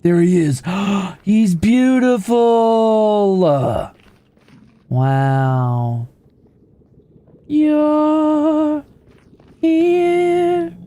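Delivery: gasp, then slow breathy-high adoring words; smitten awe. Pretend. Streamer finding the beautiful mob again, smitten. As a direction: marvel at him like true love.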